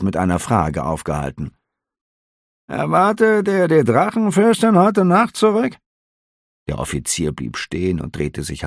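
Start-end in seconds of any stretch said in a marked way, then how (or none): 2.7-5.8 high, curious innkeeper; a nosy innkeeper asking lightly; innkeeper's voice. A narrator's reading. Innkeeper questioning the officer, high and curious.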